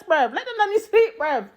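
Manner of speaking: usual speaking voice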